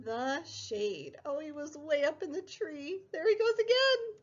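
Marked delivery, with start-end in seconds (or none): 3.1-4.2 higher, louder, fast delight; excited. Acted. Teacher cheers to kids as Sammy runs off, excited. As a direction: squeal it as the puppet dashes off. Spotting hidden puppet Sammy.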